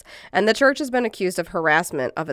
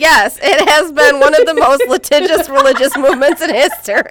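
laughing through words; 9 semitones higher, 11 dB louder than usual. Real, not acted. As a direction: laugh while reading it. Laughing through the read line.

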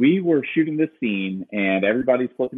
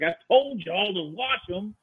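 high, slow Southern scolding; mock Southern scold; Kent's Southern accent; 10 semitones higher, slower than usual. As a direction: drawl it out like an upset Southerner. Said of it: "he's like".

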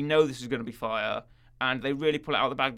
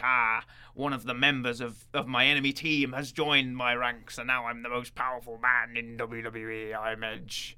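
Edge impression; 4 semitones lower, 3 dB quieter than usual. Mocking Edge's boastful promo in a low growl. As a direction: growl it out like a smug wrestling villain. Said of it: "That's exactly how he sounds".

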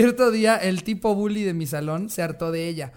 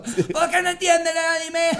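grito agudo exagerado; berrinche otaku; 11 semitones higher, 4 dB louder than usual. Acted. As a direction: imita a la morra otaku chillando. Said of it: "escuchó una morra que se puso". (chillido burlón de fan del anime)

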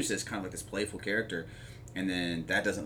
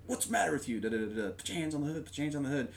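higher, barked imitated order; mock cop command; 6 semitones higher, much faster than usual. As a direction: play the sheriff bossing him at the car. Sheriff ordering Rambo.

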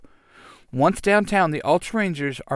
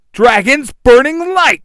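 battle cry; 11 semitones higher, 12 dB louder than usual. Acted. Hero casts a power move.

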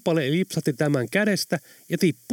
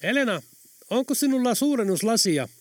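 higher-pitched call to someone out of sight; raised, carrying voice; calling from another room; 9 semitones higher than usual. A narrator's reading. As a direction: call out a question through the house. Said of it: "Pekan etäinen ääni".

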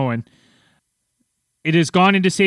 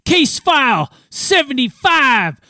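loud, high announcer shout with stretched words; hyped-up show opener, slow and high; ring announcer; 10 semitones higher, 5 dB louder, much slower than usual. Acted. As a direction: bellow the title like a fight announcer, dragging out the last word. Hammed-up episode opening announcement.

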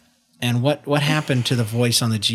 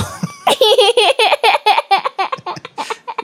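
high squeal laugh; 4 dB louder than usual. Real, not acted. Laughing at child.

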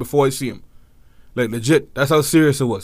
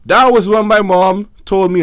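ordinary talk

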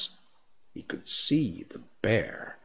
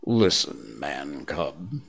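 much lower, a rumbling bear's warning; rumbling like thunder; 7 semitones lower, slower than usual. Acted. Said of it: "his voice rumbled like thunder".

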